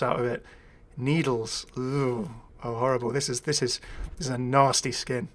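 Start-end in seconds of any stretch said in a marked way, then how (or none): none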